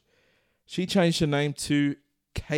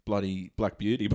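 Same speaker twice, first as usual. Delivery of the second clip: normal talking voice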